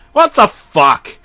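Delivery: loud, high, slow outburst; shouted what the fuck. Acted. Narrator yelling at the tape in the office. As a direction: yell it loud enough for the boss to hear. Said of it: "I yelled audibly".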